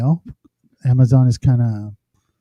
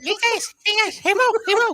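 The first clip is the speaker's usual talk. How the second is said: squeaky high voice; 4 dB quieter than usual. Pretend. Playing with bass-less filter.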